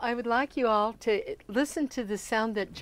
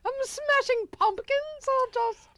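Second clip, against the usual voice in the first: posh lady voice. Acted. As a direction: play a grand English lady. Continues posh lady bit.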